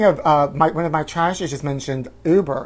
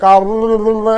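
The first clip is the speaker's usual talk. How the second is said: loud, higher gibberish imitation; mock phone babble; 5 semitones higher, 8 dB louder than usual. Comic imitating the driver on a call, loud babble. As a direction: rattle off loud nonsense syllables. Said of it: "And it was like".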